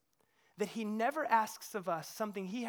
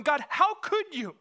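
loud, slower, outraged plea; 7 semitones higher, 8 dB louder, slower than usual. Retold or imitated. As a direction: accuse God, appalled.

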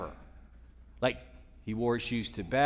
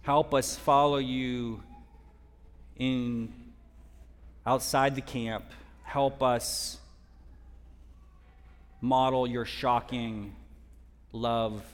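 prayer. Real, not acted. Leads closing prayer.

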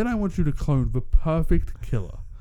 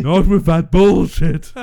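loud slow retort; 4 semitones higher, 10 dB louder, slower than usual. Real, not acted.

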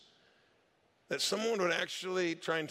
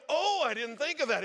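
high, loud mock surprise; playing dumb; 8 semitones higher, 5 dB louder than usual. Staffer strings the scammer along, mock clueless. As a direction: feign a sudden innocent realization. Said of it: "he decided to have some fun with it".

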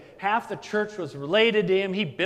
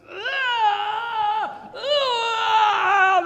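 high, loud mock scream of the damned; imitated screams; 5 dB louder than usual. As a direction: wail like a soul in hell, hammed-up. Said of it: "They're screaming like this".

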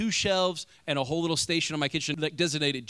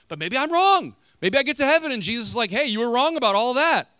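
playful emphasis; 9 semitones higher, 6 dB louder than usual. Acted. Imagining Jesus correcting him.

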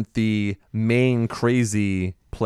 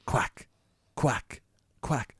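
soft quacking duck noises; 11 dB quieter, much slower than usual. Pretend. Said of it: "Most threatening sound you could possibly".

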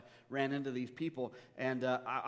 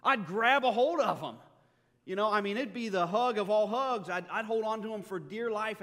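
louder and higher, bursting with joy; overjoyed embrace; 5 semitones higher, 7 dB louder than usual. Acted. Preacher picturing a lost loved one returned, joyful and loud. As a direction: cry it out like you finally got them back. Imagining meeting the risen dead.

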